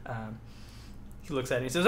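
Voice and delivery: ordinary talk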